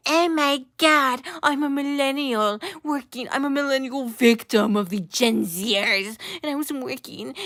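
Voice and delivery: hammed-up victim whine; mocking show voice. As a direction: parody the show playing victim. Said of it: "this show is like".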